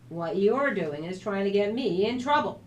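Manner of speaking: usual speaking voice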